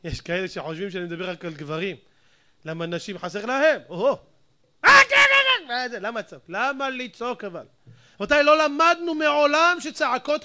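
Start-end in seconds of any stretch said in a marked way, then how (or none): 4.8-5.7 high, loud burst of mock yelling. Preacher mimicking people who scream at home, mock yelling. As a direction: imitate a screamer, pitched way up.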